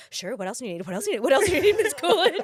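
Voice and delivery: grovel to please a star; mock eager servility. Crew fawning over Jennifer Coolidge, eager servility. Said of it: "We were just like".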